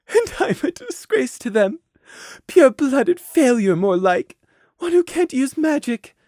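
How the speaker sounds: high, tearful inner voice; self-loathing. Acted. Mare's secret thoughts on her noble parents, tearful self-loathing. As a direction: condemn yourself in despair.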